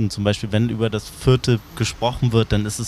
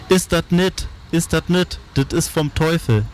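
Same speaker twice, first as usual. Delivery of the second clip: screaming woman; 8 semitones higher than usual. A narrator's reading. Silver-haired woman confronting the boys, screaming. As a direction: cry out shrilly high as the narrated woman. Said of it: "und schrie".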